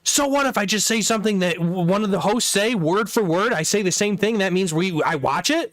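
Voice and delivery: high-pitched imitation of an indignant guy; mock angry. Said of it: "he'd get so mad".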